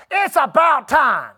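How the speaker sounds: loud, drawn-out mock shout; hammed-up loud, stretched reply; imitated exasperated outburst. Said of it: "said it like this".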